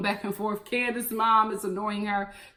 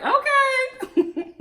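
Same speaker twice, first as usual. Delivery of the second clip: skeptical okay; 12 semitones higher than usual. Retold or imitated. Reaction to broom jump.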